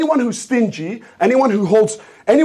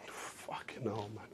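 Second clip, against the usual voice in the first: real whisper; 12 semitones lower, 27 dB quieter, slower than usual. Real, not acted. Muttered after being told to sit down.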